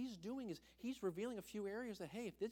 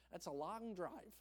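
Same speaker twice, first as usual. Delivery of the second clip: ordinary delivery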